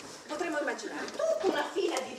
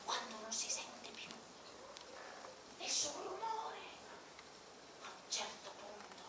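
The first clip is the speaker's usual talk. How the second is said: hushed storytelling; 16 dB quieter than usual. Acted. Hushed night scene before the alarm.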